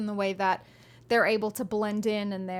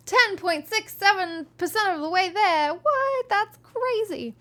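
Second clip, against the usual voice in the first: much higher, louder astonished outburst; amazed; 10 semitones higher, 6 dB louder than usual. Real, not acted. Streamer seeing the fundraiser total jump, amazed. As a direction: squeal in disbelief at the number. Reacting to donation goal progress.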